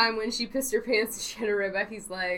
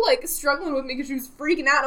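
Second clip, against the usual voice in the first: ordinary talk, nothing marked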